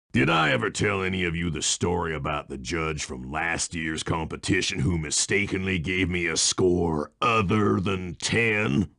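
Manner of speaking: ordinary talk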